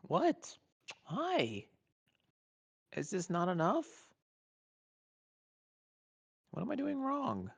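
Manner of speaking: high, bright sing-song voice for a pet; high pet-talk voice. Real, not acted. Asking her pet what she's doing wrong, in a high coo.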